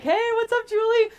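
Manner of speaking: high, loud hello called across the street; overconfident greeting. Retold or imitated. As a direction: greet the wrong person with total confidence. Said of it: "I was just so confident".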